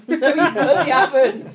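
laughing. Real, not acted. Laughing retort.

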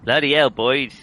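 retry it firmly in Cornish; emphatic West Country farmer voice; corrected Cornish attempt. Shown as a demonstration. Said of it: "Perhaps if you don't say mate".

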